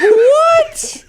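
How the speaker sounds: very high, loud squeak of disbelief; a stunned shriek; shocked what. Retold or imitated. Imitating the viewer stunned by the plot twist, high squeak. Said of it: "And you're like".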